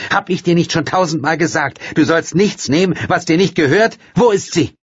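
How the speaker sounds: high, loud angry scolding; mother scolding her daughter. Acted. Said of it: "rief Leonora zornig".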